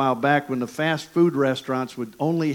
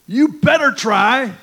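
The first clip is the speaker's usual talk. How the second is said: loud, high, slowed mock ref warning; the referee warning the chaplain, hammed-up loud and high; threatening ref; 11 semitones higher, 9 dB louder, slower than usual. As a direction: play the angry referee leaning in.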